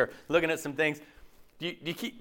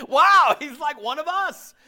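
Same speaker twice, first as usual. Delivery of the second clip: admiring onlooker; 9 semitones higher, 6 dB louder, slower than usual. Retold or imitated. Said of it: "you look at him going".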